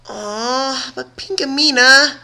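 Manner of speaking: high, slow whiny pout; pouty complaint. A narrator's reading. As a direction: drag it out like a sulking child. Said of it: "complained with a pout".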